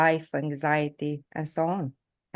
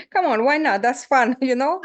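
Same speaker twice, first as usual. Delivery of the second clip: laughing, higher and louder teasing; laughing joke; 7 semitones higher, 6 dB louder than usual. Real, not acted. [name] laughing, teasing the coach. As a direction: tease back through laughter.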